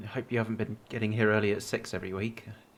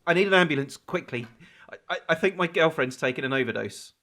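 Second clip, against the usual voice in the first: panicked call; 4 semitones higher, 9 dB louder than usual. Acted.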